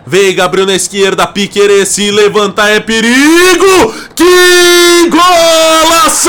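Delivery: rising play-by-play into a goal; explosive goal commentary. Real, not acted. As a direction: build from fast narration to a shout.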